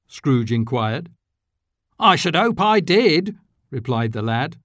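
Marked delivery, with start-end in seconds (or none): 2.0-3.4 put-on cheeky boy. A narrator's reading. Boy's cheeky reply.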